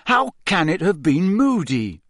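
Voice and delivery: high-pitched baffled question; disbelieving. A narrator's reading. Harry questions Dumbledore about Moody, disbelieving. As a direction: question it, unable to believe. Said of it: "in a state of complete disbelief".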